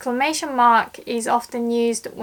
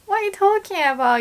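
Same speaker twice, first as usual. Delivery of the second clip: incredulous; 9 semitones higher, faster than usual. Shown as a demonstration. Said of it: "You're gonna be like".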